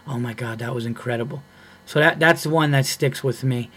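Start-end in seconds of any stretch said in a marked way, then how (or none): 0.1-1.4 hushed, soft audience murmur; imitated whispering. Audience members whispering about the comic's set, mock hushed aside. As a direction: drop it low and soft, imitating onlookers. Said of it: "hearing people in the audience whispering".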